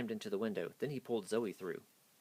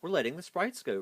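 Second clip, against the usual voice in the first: higher, louder declaration; defiant cry; 8 semitones higher, 5 dB louder than usual. A narrator's reading. Rudolph answering Ridley boldly, crying out. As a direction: stand up to the penguin. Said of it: "Rudolph cried".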